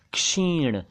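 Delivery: क्षीण begun with k plus sh, "ksheen"; correct ksh onset. Shown as a demonstration. Teacher correcting learners: क्षीण as "ksheen". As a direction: say क्षीण with a clear k before sh. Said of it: "यह है".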